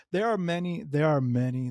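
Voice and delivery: usual speaking voice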